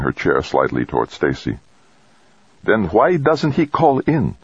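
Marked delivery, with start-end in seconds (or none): 2.6-4.4 slow, high, sharp question; almost angry demand. A narrator's reading. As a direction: press hard, nearly angry. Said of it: "she demanded, almost angrily".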